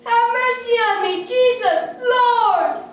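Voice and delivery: high, very loud plea. A narrator's reading. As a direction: shout for mercy across a crowd. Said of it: "he called out louder now".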